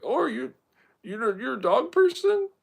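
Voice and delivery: high, loud owner's question; high put-on voice of a chatty dog owner. Voices the dog's owner asking.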